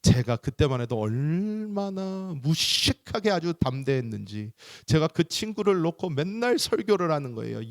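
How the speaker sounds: usual speaking voice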